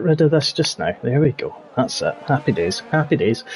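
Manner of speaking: soft, low off-mic mutter; a quiet muttered aside while tidying the screen. Real, not acted. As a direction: mumble it while busy.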